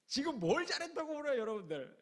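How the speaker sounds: scolding. Retold or imitated. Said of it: "이러는 거예요".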